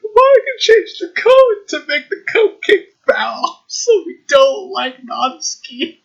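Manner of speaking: high, loud excited rush. Real, not acted. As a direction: blurt it out giddy.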